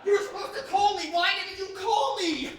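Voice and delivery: high, loud, exasperated complaint; upset loud reproach. Acted. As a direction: blurt out the blame loudly. Upset friend confronts Oscar.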